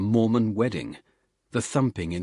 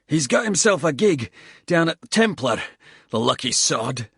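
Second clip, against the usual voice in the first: a tired squaddie cursing the climb between breaths; lightly voiced Scottish soldier, puffing; Bald, winded Scottish soldier; 6 semitones higher, 5 dB louder than usual. Bald on Smudge's new job.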